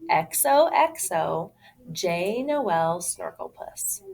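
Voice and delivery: plain reading voice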